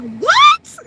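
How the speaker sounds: very high, loud shriek of "what"; re-enacted shriek of shock; shocked scream. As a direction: scream it in utter disbelief. Retells screaming at birth news.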